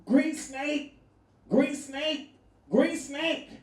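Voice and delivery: high, urgent repeated warning cry; mock local calling out a warning; local shouting warning. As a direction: call it out high like a panicked local. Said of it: "And the dude said".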